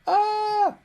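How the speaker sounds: sudden high, loud yelp; a hammed-up startled cry of alarm; mock alarmed yelp. Teacher voicing Python spotting a string and an integer, startled yelp. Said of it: "It's like".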